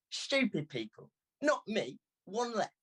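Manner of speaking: put-on high, taunting young voice; a hint of a smug girl; cheeky girl. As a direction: rub it in playfully. Girl mocking the old man.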